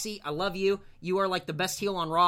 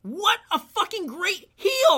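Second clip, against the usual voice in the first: excited; 13 semitones higher, 7 dB louder, slower than usual. Real, not acted. Excited praise of heel.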